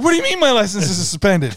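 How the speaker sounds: high, loud retold outrage; exasperated phone-call indignation; indignant protest.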